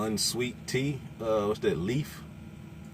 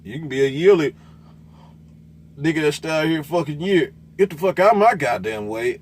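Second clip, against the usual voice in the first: loud, heated rant at other drivers; angry rant; 5 semitones higher, 7 dB louder than usual. Real, not acted. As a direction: bark it out, fed up. Ranting at other truck drivers.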